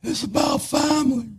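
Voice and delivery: high, dramatic, put-on delivery; cringe-worthy dramatic line. Shown as a demonstration. Speaker hamming it up to make his wife cringe, high and dramatic. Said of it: "My wife's gonna cringe when I do this".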